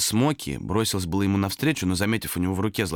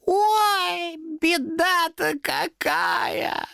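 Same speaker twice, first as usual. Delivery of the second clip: very high, drawn-out mock lament; exclaiming demon; much slower than usual. A narrator's reading. Demon sees the dead cat, exclaiming mockingly. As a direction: wail in false sympathy as the demon. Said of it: "воскликнул демон".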